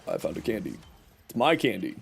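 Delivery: ordinary delivery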